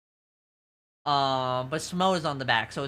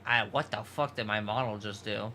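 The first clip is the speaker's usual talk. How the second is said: ordinary delivery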